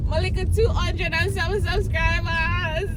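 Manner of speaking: high, delighted disbelief; thrilled gasp. Retold or imitated. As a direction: gush over the tiny number. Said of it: "I was like".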